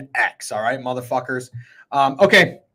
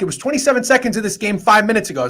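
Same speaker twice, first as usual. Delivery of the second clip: normal talking voice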